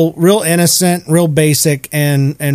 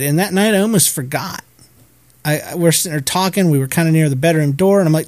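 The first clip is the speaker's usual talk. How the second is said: ordinary delivery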